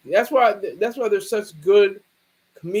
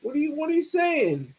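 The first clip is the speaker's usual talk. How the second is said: higher mock scolding of a boyfriend; mad girlfriend; 8 semitones higher than usual. Girlfriend accusing her man of a boy on the side, mock angry. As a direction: scold him like a suspicious girlfriend, pitched up. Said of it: "She was mad. She was like".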